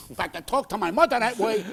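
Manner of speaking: normal talking voice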